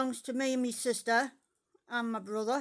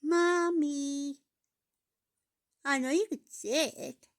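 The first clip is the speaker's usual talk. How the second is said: put-on pet baby talk; 6 semitones higher than usual.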